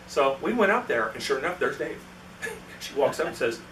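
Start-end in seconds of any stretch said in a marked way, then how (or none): none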